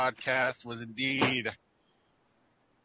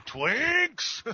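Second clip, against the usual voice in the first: high yelled outburst, much louder; mock ogre yell; 13 semitones higher, 4 dB louder than usual. Ogre guy bursting in with a loud mock yell. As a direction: belt it like a huge ogre.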